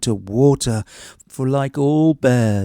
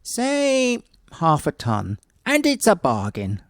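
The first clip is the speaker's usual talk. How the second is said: lightly voiced woodman naming his price; woodman's offer; 5 semitones higher than usual. Woodman bargaining.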